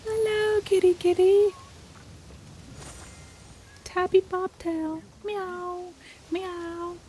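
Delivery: high soft meowing to a cat; cooing at a kitty. Real, not acted.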